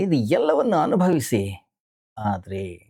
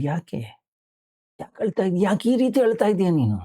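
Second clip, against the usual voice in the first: higher, rising alarmed questioning; alarmed disciples; 8 semitones higher than usual. Retold or imitated. Disciples alarmed, asking their weeping guru why. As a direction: ask in startled worry why he weeps.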